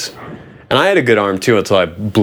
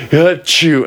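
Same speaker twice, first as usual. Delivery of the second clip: imitated sneeze; 7 semitones higher than usual. Retold sneeze, acted out.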